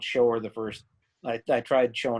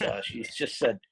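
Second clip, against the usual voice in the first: usual speaking voice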